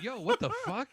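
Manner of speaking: high, shocked "what the fuck"; shocked outburst. Retold or imitated. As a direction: jump up in pitch, caught off guard. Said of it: "you're like".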